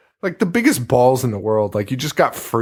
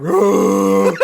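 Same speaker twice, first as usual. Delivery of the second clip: mock monster yell; 8 semitones higher, 6 dB louder than usual. Imitating monster noise.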